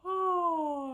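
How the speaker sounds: loud high yawning "Oh". Shown as a demonstration. Teacher yawning while massaging the jaw, loud high. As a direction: yawn through the sound. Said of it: "you can yawn while you do this".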